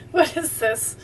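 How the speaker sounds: laughing, soft question; amused laugh. Real, not acted. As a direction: giggle as you open it. Amused by bumper sticker.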